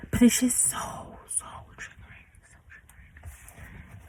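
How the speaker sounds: whispered, worn-out so triggering, slowing at the end; exasperated whisper. Real, not acted. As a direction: breathe it out as a whisper, fed up. Exasperated about her mom.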